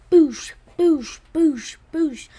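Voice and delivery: shouted punchy boosh boosh; loud boosh sound effects. A narrator's reading. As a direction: bash out each boosh like a blow. Toucan pounding the ants.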